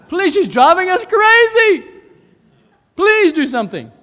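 high, shouted, fed-up begging; exasperated appeal. Acted. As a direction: shout it like you're being driven crazy. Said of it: "the disciples come to Jesus and say".

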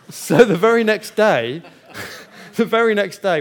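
laughing, loud and high. Real, not acted. Preacher laughing as he tells the congregation, loud. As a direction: laugh while telling it. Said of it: "laughing retelling".

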